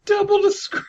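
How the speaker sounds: very high, squeaky begging voice; mock pleading squeak. Begging a friend to double the screen in a squeaky mock plea. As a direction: whine it like a begging kid.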